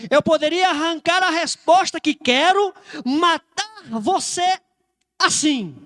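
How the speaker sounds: loud threat with the last words drawn out for effect; nervous threat. Acted. Centurion threatening Maria Madalena to force an answer, agitated. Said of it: "e ele nervoso, andando pra um lado".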